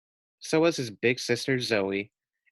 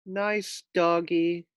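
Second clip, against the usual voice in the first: higher, slow nervous coax; 7 semitones higher, much slower than usual. A narrator's reading. Pig nervously coaxing a passing dog, higher and slow.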